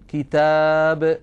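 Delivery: كتاب slowly as "kitab", final b stopped with no nun; slow "kitab" with no nunation; pausal form. Shown as a demonstration. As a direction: stop on the still "b" of كتاب, drawn out. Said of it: "فيقفون على كتاب".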